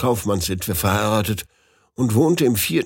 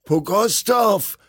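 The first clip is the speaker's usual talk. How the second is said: much higher, slow startled call; exclaimed; 11 semitones higher, slower than usual. A narrator's reading. Narrator recognizing his friend on the stairs, startled. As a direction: call out the name in shock. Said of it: "rief ich aus".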